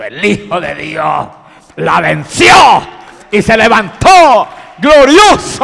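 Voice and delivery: proclamación a gritos, mucho más aguda; grito triunfal. Acted. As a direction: gritarlo como una victoria gloriosa. Predicador proclama la victoria de Cristo. (proclama a gritos, triunfante)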